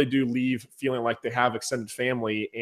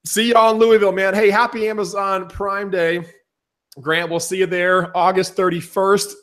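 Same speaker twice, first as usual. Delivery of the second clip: excited sign-off; 6 semitones higher, 5 dB louder than usual. Real, not acted. Enthusiastic sign-off.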